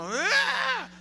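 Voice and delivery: sudden high loud shout; exorcist war cry. Retold or imitated. Preacher voicing an exorcist spotting the devil, loud cry. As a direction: burst out like a charging exorcist. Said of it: "la pose del liberador".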